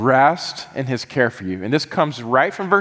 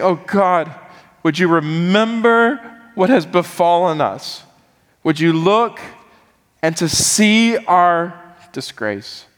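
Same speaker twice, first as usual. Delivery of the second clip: higher, pleading prayer voice; earnest prayer; 5 semitones higher than usual. Acted. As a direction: pour it out to God like a heartfelt prayer. Voices Jeremiah's prayer at length.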